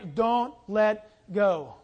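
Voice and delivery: Don't let go drawn out slowly; slow emphatic. Shown as a demonstration. Pastor pressing the point to the congregation, slow emphasis. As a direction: lean hard on each word. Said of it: "preaching emphasis".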